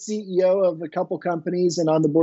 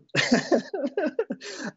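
light laugh; 9 semitones higher, 6 dB quieter than usual. Real, not acted. Laughs at own joke.

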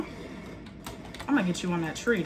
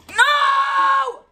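high, loud drawn-out wail; loud high cry of defeat; dismayed shout; 11 semitones higher, 7 dB louder than usual. Real, not acted. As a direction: scream it in gamer frustration. Dismay at dying in game.

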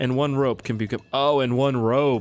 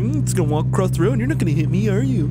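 lightly voiced Kermit, high and fast; a hint of a sassy Kermit puppet, rattled off quick; Kermit the Frog voice; 7 semitones higher, faster than usual. Deer on the road voiced like Kermit, taunting the driver. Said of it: "the Kermit the Frog voice".

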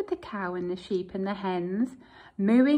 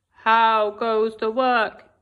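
lazy farmer calling; 8 dB louder, slower than usual. Acted. Lazy farmer calling from bed to the duck. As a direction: call down lazily from bed.

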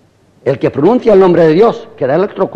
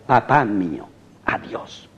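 tender whisper of love; 6 semitones lower, 7 dB quieter, slower than usual. Acted. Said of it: "cuando de dentro le subía la ola de la ternura".

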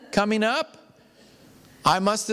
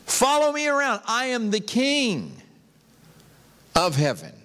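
higher-pitched, ringing call to follow; commanding invitation; 4 semitones higher than usual. Acted. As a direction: call the followers along with authority. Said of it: "he was saying".

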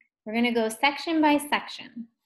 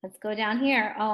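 normal talking voice

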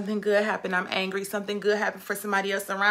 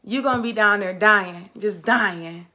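ordinary talk

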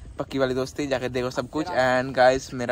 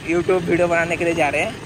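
ordinary talk, nothing marked

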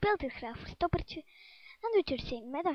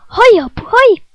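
hoog en heel luid gejuich; vrolijke uitroep; 8 semitones higher, 23 dB louder than usual. Acted. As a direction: juich het uit van plezier. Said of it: "dacht Erik vrolijk". (Erik juicht: hoog en luid)

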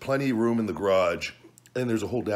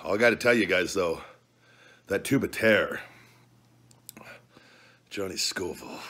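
ordinary talk

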